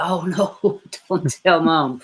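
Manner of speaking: hammed-up dread of mom finding out; mock panic. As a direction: beg like a caught kid. Acting as a scared kid.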